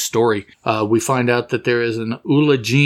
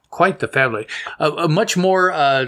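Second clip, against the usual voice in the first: ordinary delivery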